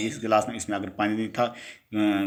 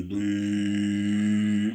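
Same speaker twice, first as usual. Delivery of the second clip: long, level humming bee buzz. Shown as a demonstration. Teacher shows pupils the sound a bee makes, sustained buzzing hum. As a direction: hum like a bee on one held note. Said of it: "وہ آواز جو نکلتی ہے".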